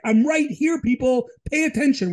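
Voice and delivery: God calling out. Pretend.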